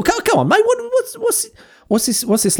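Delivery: mock exasperation. Host pleading with Darwin over his insults, mock exasperated and high. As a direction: throw up your hands in hammed-up despair.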